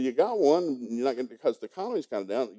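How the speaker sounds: usual speaking voice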